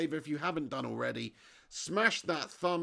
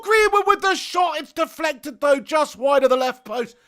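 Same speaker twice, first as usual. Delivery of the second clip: high, loud live football commentary; excited play-by-play; 11 semitones higher, 14 dB louder than usual. Real, not acted. Streamer calling Greenwood's shot live, excited commentary. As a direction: erupt as the shot comes in, then ride the action.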